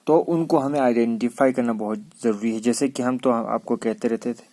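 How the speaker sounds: ordinary talk, nothing marked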